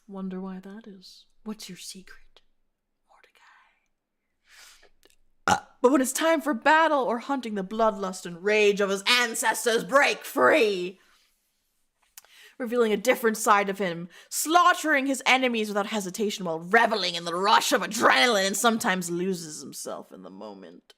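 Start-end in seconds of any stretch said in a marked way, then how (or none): 1.4-3.4 very soft whisper, fading away; hushed, teasing question; conspiratorial whisper. Pretend. Mock intimate question to the character. 5.5-20.9 forceful, building battle narration; emphatic storyteller, easing off at the end; dramatic narration. Acted. Reads battle passage dramatically.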